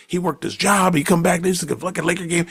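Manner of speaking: ordinary talk, nothing marked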